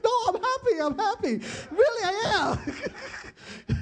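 high, loud laughing protest; squeaky laughing denial; laughing insistence. Real, not acted. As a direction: laugh it off while insisting you're fine. Insisting to wife he's happy.

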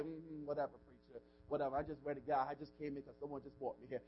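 mock hard-hearted churchgoer, low and soft; dismissive. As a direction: brush the preacher off under your breath. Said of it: "some of you got a hard heart".

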